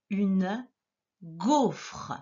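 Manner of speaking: UNE GAUFRE with a gap between words; word dictation. Shown as a demonstration. Teacher repeating a word for dictation, carefully separated. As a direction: say UNE, pause, then GAUFRE with sounded ending. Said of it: "Le premier mot".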